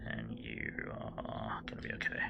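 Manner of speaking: soft breathy whisper. Pretend. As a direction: whisper it gently. Repeats reassurance in new voice.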